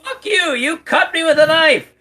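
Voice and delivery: high-pitched outraged yell; loud, indignant shriek of protest; angry outburst. Acted. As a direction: yell it high, furious at being cut. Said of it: "keep the noise down just a little bit".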